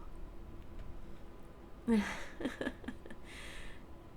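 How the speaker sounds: soft, breathy run of chuckles; amused chuckle. Acted. Villain pleased with the rival's answer, soft chuckle.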